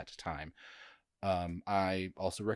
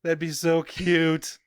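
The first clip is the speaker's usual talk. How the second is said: gushing cute; 7 semitones higher, 5 dB louder, much slower than usual. Acted. Gushing over imagined celebrity baby.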